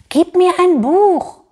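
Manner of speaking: high, loud, slow lisping demand; lisping creature. A narrator's reading. Furry pig demanding a book from Stan, lisping. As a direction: lisp it in a high little creature voice. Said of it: "lispelt das Pelzschwein".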